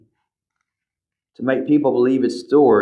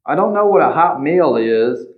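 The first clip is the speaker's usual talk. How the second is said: usual speaking voice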